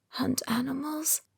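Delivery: unvoiced questioning whisper. A narrator's reading. Weak patient whispering a question to Ben. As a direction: breathe the question. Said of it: "I whisper".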